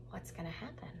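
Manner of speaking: very soft, building suspense; hushed suspense. A narrator's reading. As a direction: hold your breath and ask softly. Suspense question to kids.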